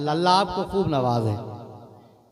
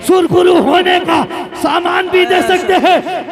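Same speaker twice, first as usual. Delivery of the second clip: slow, high, loud declamation; shouted verse recitation; 11 semitones higher, 9 dB louder, slower than usual. Real, not acted. Preacher repeating the verse to rouse the gathering, shouted recitation. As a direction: belt the line out slowly, ending on a rise.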